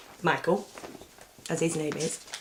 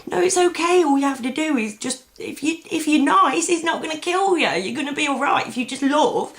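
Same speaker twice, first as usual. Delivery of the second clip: high, pleading reassurance, pushed up loud; mock soothing plea; 9 semitones higher, 4 dB louder than usual. Calming panicked people in the dream, high and pleading. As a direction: imitate yourself urgently calming a crowd. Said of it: "And I'm like".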